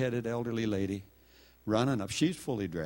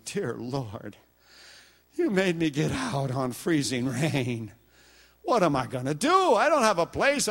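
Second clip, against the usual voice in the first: rising, louder pleading prayer ending in a high cry; desperate plea; 9 semitones higher, 6 dB louder than usual. Retold or imitated. Man stranded in freezing rain pleading with God, building.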